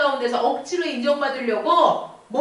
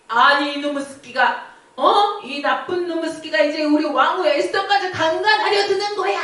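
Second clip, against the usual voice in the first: the king bursting out at the sight; outraged king catching Haman at the queen's seat; furious king; 4 semitones higher than usual. Acted.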